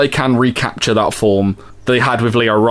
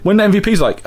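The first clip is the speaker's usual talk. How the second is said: usual speaking voice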